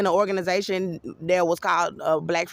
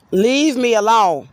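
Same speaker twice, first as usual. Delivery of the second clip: loud, slow, raised-voice demand; real angry warning; 5 semitones higher, 7 dB louder, slower than usual. Real, not acted. Speaker warding off someone following them, angry. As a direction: push them away with a loud voice.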